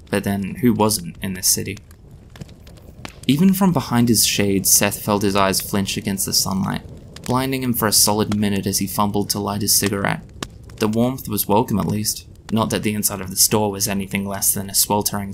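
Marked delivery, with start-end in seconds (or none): none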